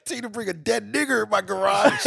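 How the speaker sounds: loud, high, slowed movie quote shout; quoting Tarantino. Retold or imitated. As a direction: yell the movie line, laughing through it. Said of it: "when he said".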